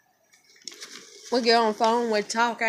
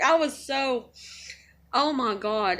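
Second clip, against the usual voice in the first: usual speaking voice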